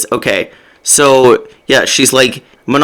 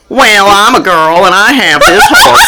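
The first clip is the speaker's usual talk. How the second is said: silly southern girl impression, close to laughing; mock southern accent; 5 semitones higher than usual. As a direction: imitate a bad audiobook southern accent. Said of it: "gave Ansel a southern accent".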